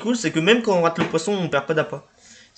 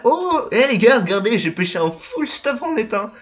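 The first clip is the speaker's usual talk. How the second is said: excited; 7 semitones higher than usual. Real, not acted. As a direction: shout it out, thrilled. Showing off a catch. (excité)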